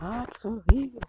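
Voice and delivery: loud high startled cry; startled awake. Real, not acted. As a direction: jolt awake from a nightmare. Waking from nightmare.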